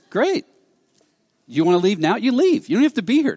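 acting out a blunt "go ahead"; retold dismissive retort. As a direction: show him the door, unbothered. Acted retort telling him to leave.

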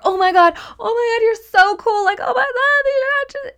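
very high, loud, near-tearful gushing; squealing fangirl. Retold or imitated. A fan meeting her idol, high teary squeal. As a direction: squeal like a fan about to cry. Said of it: "goes into fan energy of".

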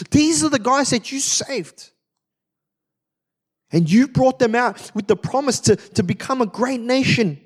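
high, impassioned pleading to God; urgent plea. Acted. Preacher voicing Moses pleading with God.

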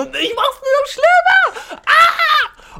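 very high, drawn-out comic shriek; panicked scream. Acted. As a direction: wail it loudly in mock panic. Acted panic while making it worse.

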